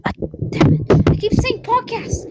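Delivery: high, loud exasperated cry; frustrated outburst. Real, not acted. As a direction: yelp at your own slip.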